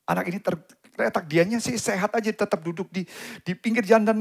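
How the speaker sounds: ordinary delivery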